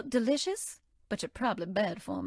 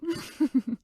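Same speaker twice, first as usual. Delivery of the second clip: tickled laugh; 3 semitones higher than usual. Acted. Tickled by the player.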